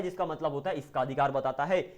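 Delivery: ordinary delivery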